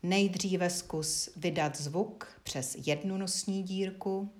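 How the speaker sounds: usual speaking voice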